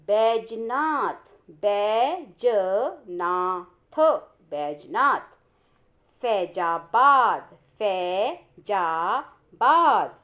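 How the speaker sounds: each ai word drawn out very slowly; slow word reading. Shown as a demonstration. Teacher reading word list slowly for pupils. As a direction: read each word slowly, stretching the ai vowel. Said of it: "पहले इन शब्दों को पढ़ते हैं।".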